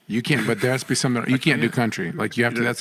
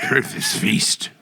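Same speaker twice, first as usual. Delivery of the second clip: mock lord; much slower than usual. Mock lord's feast call.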